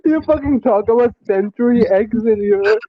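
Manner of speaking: laughing. Real, not acted. Laughing while teasing.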